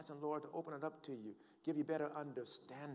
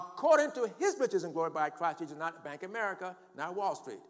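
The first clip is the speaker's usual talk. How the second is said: loud, raised pulpit declaration; impassioned, forceful preacher; full-force preaching; 6 semitones higher, 11 dB louder than usual. Real, not acted. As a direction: proclaim it boldly to the congregation.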